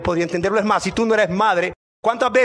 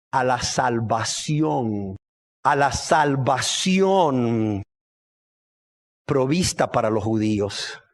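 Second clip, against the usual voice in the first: very slow, low, drawn-out delivery; slow weighty repetition; solemn emphasis; 9 semitones lower, slower than usual. Real, not acted. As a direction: let each word stretch out, pitched low. Preacher answers his own question solemnly.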